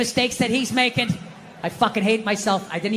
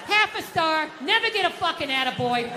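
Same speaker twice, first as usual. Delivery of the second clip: higher-pitched mock critic; mock bad review; 7 semitones higher than usual. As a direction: read out a scathing review of your husband. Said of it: "bad reviews on the fucking husband".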